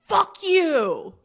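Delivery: ex-husband's loud, high yell re-enacted; imitating a furious yell, high and falling. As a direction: belt it out as a drawn-out angry shout. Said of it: "He was yelling at me".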